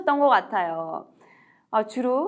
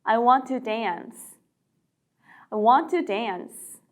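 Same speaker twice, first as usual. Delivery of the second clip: slow, falling "I want to dance."; slow model sentence; much slower than usual. Shown as a demonstration. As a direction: read slowly for repeating. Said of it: "춤추고 싶어요". (천천히 따라 하기)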